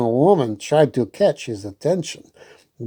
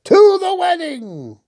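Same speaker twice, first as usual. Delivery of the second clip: high ringing toast; toasting cry. Acted. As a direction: raise the glass and cry it out. Hubert toasts the wedding.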